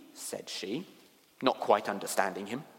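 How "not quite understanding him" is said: plain reading voice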